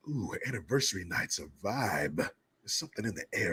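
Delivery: sultry voice. Pretend. Sultry mood-setting about anniversary.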